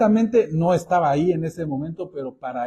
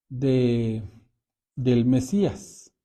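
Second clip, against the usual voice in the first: ordinary talk, nothing marked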